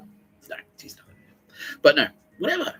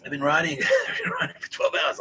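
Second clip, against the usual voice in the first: much higher, laughing whine; whiny excuse; 4 dB louder than usual. Retold or imitated. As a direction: whimper like a worn-out kid. Whining to his tough coach.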